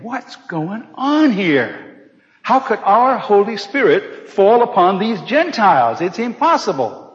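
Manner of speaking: high, slow, amazed; drawn-out disbelief as Peter; Peter astonished. Acted. Peter seeing the Spirit fall on Gentiles, astonished. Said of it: "And Peter is saying".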